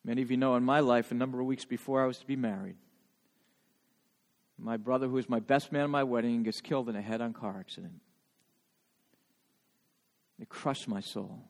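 low, hushed account of a real loss; real grief, held low and quiet; grave, subdued recollection. Real, not acted. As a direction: recall a painful bereavement softly. Recalls his brother's fatal crash.

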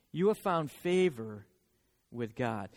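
slow, hushed reading of the angel's words; gentle scripture read. Acted. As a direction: read it softly, like holy text. Repeats the verse, slower and softer.

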